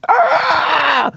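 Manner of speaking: high, drawn-out shriek; scream of pain. Acted. Foe struck by lightning, high scream. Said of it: "snaps back into him, like".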